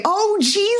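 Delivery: high, squeaky cry of dismay; a silly squeal of alarm; mock dismay. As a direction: pitch it way up in horror. Reading own exasperated viewing notes.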